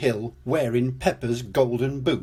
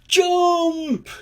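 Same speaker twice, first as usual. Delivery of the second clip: very high, louder shout; excited shout; 5 dB louder than usual. Acted. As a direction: shout the cue to leap. Children jump after countdown.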